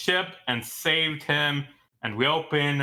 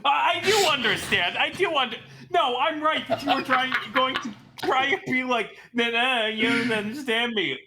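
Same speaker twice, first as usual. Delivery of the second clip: defensive high protest; 8 semitones higher than usual. Real, not acted. Defensive argument, high pitch.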